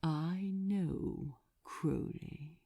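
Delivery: a demon luring his underling in a soft voice; soft and alluring. Acted. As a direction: lean close and coax him gently. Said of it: "so soft and alluring".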